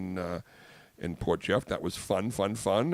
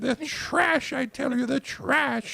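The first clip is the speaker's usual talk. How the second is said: put-on very high, loud, slow falsetto old lady; 7 dB louder, slower than usual. Host teases co-host's mother in a high slow old-lady falsetto. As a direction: croon at her like a doting old biddy. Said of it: "do Mumsy".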